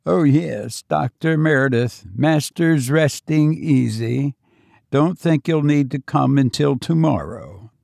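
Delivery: plain reading voice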